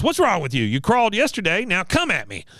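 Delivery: mock goading. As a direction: goad a recruit, hammed up. Acting Cotton goading Peggy.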